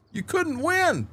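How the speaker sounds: high-pitched exasperation; high storyteller's lament; exasperated punchline. Real, not acted.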